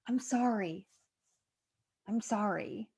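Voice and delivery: soft, subdued retold apology; quiet apology. Grandmother apologizing after hearing herself in her grandson, quiet and subdued. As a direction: apologize softly, letting it drop away.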